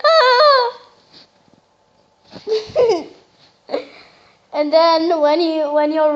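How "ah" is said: imitating a high shrieking "Ah!", loud; high, loud mock squeal; mock excited scream. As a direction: shriek it like the kid does when excited. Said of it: "You're like".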